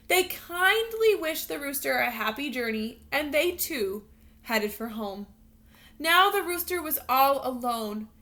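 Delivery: expressive read-aloud storytelling voice; storybook narration. Acted. Teacher reading a picture book to her students, lively storytelling voice. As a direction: read it like a bedtime picture book for little kids.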